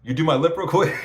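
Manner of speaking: high and loud, through a laugh; amused quote asking for a lip tattoo; laughing retold request. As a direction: blurt the request while laughing. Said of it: "I was like".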